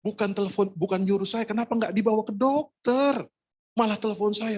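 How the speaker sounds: high-pitched comic complaint; acting out silent disbelief; exasperated inner protest. Inner protest at being called.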